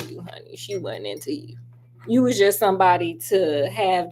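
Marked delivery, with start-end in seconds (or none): 0.1-1.6 whisper. Real, not acted. Aside to the third suitor.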